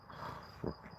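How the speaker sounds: under-the-breath whispered oath; muttered to himself, unvoiced; whispered curse. Real, not acted. Stumbles reading Welsh place name.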